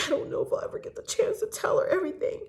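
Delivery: high, quick, whimpering fake sob; hammed-up tearful wail with gasping breath; mock sobbing. Host imitates Tom sobbing to Lisa, high mock-crying voice. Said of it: "he begins sobbing, right?".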